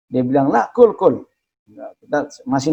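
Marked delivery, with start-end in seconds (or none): 0.5-1.3 higher, louder insistence; won't take no for an answer; insisting host. Retold or imitated.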